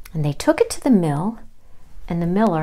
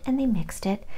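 plain reading voice

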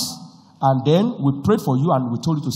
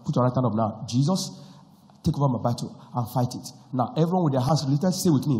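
ordinary talk

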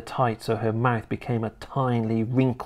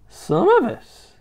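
put-on high, slow old hag; high witch voice; 4 dB louder, slower than usual. A narrator's reading. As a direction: announce it as a witch. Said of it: "the old woman went on".

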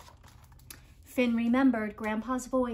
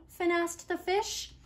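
ordinary delivery

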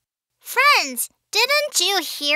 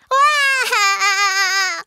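bursting into tears. Acted. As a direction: bawl like a helpless little child.

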